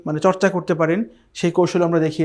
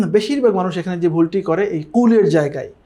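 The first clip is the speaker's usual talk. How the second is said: normal talking voice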